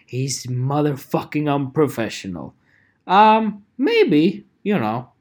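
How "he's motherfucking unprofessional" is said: whispered, lower mock complaint; mock critic whispering. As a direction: hiss it like a gossiping listener. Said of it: "And people go like".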